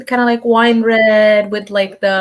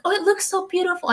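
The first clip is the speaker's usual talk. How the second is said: gushing praise; 9 semitones higher, 5 dB quieter, faster than usual. Retold or imitated. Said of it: "they're both like".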